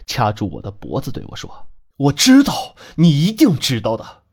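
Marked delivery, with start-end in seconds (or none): 2.0-4.2 loud, raised-pitch outburst; frenzied insistence. Acted. Man choking the girl for an answer, frenzied shout. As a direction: shout it like a man losing his mind. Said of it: "他疯了一样冲过来".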